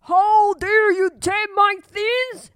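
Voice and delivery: the giant yelling at Jack, furious; angry giant. Acted. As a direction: roar it at the thief. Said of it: "He was very angry".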